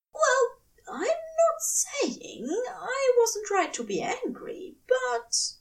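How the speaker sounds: high, hushed devoted angel voice; tender angel. A narrator's reading. Angel vowing to his lover, high and hushed.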